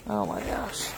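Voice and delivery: breathy under-breath oh my gosh; whispered exasperation. Real, not acted. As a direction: sigh it out under your breath. Exasperated at dumped feed.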